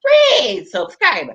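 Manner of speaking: normal talking voice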